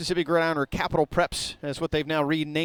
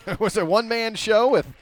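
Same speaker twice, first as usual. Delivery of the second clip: chuckling through the words; a sheepish laugh as he talks; amused at his own one-man setup; 5 semitones higher, 3 dB louder than usual. Real, not acted. Self-deprecating laugh at camera mishap.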